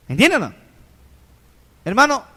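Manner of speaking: loud rhetorical demand to the congregation; calling out. Real, not acted. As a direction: shout the question at the crowd. Preacher calls to congregation.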